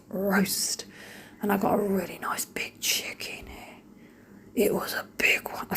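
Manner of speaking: hushed, slow confiding whisper; whispered aside. Real, not acted. Mum whispering to viewers about the roast chicken, hushed. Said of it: "whispering aside about the chicken".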